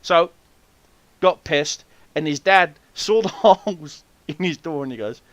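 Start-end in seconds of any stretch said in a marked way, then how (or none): none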